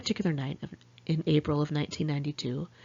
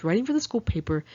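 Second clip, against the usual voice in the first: ordinary delivery, nothing marked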